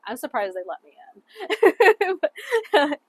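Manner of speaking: loud, breaking into laughter; amused, slow and loud, tipping into laughter; laughing. Real, not acted. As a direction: say it through a real laugh. Joking about being let in.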